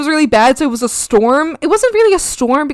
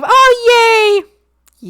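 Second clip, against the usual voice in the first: high, loud happy squeal; excited oh yay; 12 semitones higher, 8 dB louder than usual. Real, not acted. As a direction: cheer for her. Cheering for friend's arrangement.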